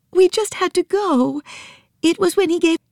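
put-on high girl's voice, tearful; small girl weeping with joy at her elephant; Nancy weeping. Little girl tearfully greeting her elephant, high voice.

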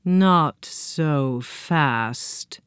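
very slow, low, ominous. A narrator's reading. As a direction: drag it out with menace. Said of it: "an ominous but familiar voice".

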